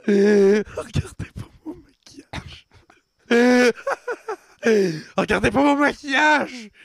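laughing mockery. Mocking friend's emo line.

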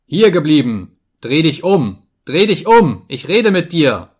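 roaring demand to be obeyed; furious bellow. A narrator's reading. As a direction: bellow each command at someone walking away. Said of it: "mein Brüllen klang wohl sehr ungewöhnlich".

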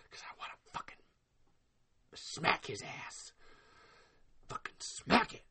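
whispered, slowing threat through pent-up anger; seething whisper. Real, not acted. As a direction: drop to a whisper, barely contained. Seething wish to hit Glenn.

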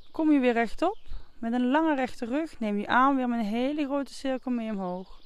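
normal talking voice

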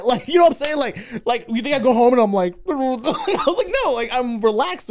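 high, loud, talking through real laughter; laughing. Real, not acted. Telling co-host about relaxing at home, laughing. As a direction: crack up while protesting.

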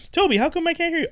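very high-pitched, singsong complaint; sitcom-style calling. Pretend. Calls to a muted friend, high sitcom whine. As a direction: call out to Toby way up high.

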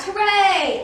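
loud, high hooray; joyful cheer. Acted. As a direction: burst out cheering. Iguana claps, glad of help.

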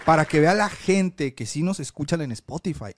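ordinary delivery

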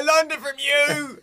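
very high, drawn-out mock scream; hammed-up outburst. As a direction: yell back at dad, slow and melodramatic. Acted child yelling back.

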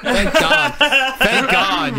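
high laughing relief, slowing down; giggling thanks through real laughter. Real, not acted. Laughing relief after Russian bit.

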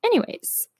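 jumps up high, then falls; sing-song high "anyways". Real, not acted. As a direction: toss it off, high and light.

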